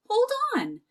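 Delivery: high, sharp call after a runaway; yelled. Acted. Jack yelling at the fleeing leprechaun, high-pitched shout. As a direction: shout it up high to stop him. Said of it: "Jack yelled".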